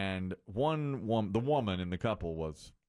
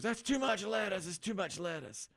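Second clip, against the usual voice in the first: fussy customer; 10 semitones higher than usual. Retold or imitated. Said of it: "She goes".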